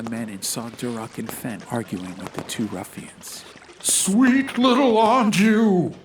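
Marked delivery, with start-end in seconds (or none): 3.8-5.9 louder, slow anguished yell. Acted. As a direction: cry her name in despair. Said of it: "yelled Duroc".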